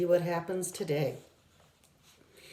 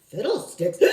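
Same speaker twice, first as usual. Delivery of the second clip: grumbling. Acted.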